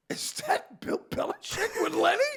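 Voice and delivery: high-pitched incredulous question; imitated astonishment. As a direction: spot a celebrity and can't believe it. Imitating Dennis spotting a celebrity.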